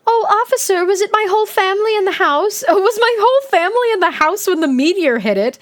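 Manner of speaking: high, breathless, fake-naive gushing; a silly, high, put-on voice; mock innocent victim. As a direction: play the sweetly clueless survivor. Mock-innocent quote, pretend scene.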